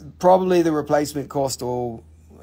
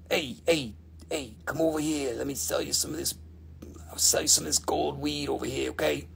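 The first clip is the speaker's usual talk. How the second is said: shady street dealer; 3 semitones lower, 10 dB quieter than usual. Pretend. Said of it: "It's gonna be like".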